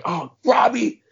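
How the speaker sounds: high, loud exasperated groan of a name; pleading protest. Retold or imitated. Young player objecting to his coach, pleading protest. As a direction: protest to the coach, pitched right up.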